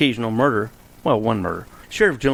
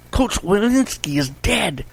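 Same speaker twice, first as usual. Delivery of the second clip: put-on high, slow, breathless boy's cry; a boy blurting out shocking news; excited child; 9 semitones higher, slower than usual. Bobby tells the sheriff the coach is dead, excited high voice.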